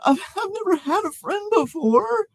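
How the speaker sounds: high giddy voice; excited crybaby. Acted. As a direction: gush like a kid finally offered a friend. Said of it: "excitedly exclaimed Pete".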